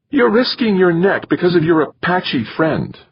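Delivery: high-pitched, upset female voice; upset wife. A narrator's reading. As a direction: reproach him, voice pushed way up high. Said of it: "She was really upset".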